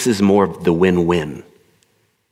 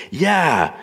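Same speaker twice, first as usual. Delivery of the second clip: put-on eager, high little-kid yeah; child in back seat; 9 semitones higher than usual. Child agreeing to a parent's offer, high and childlike. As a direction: agree like a small child, pitched up. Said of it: "like a child in the back seat".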